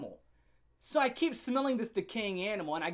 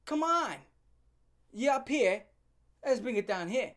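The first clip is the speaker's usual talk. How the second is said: mock calming; 6 semitones higher, 4 dB louder, slower than usual. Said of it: "And I'm like".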